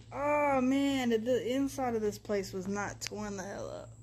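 ordinary talk

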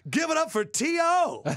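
very high, loud MC call; big re-enacted arena introduction; announcer shout.

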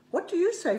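playful question to the possum; sing-song question. Acted. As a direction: coax the possum to answer. Asking the possum.

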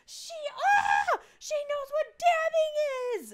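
high, loud, slow squealing shout; loud amazed kid voices; excited kids shouting. Retold or imitated. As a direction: shriek it like kids who can't believe it. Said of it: "They're like".

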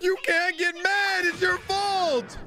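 high, exasperated outcry; high-pitched comic indignation; incredulous scolding. Real, not acted. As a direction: protest high, drawing out the end. Scolds Luffy over the whale.

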